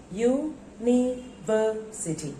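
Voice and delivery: UNIVERSITY slowly broken into separate syllables. Shown as a demonstration. Teacher confirming five syllables, spaced out. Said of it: "Five syllables are there".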